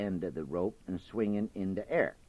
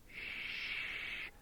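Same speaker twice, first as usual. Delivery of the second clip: sharp whispered shush; hushing shh; 14 dB quieter than usual. Acted. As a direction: hush him with a sharp shh. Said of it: "holler up Brer Rabbit".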